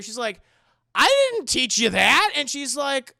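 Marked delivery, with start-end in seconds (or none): 0.9-2.3 high, loud incredulous protest; baffled, raised-voice denial; acted astonishment. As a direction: exclaim in disbelief. Mother astonished by piano playing.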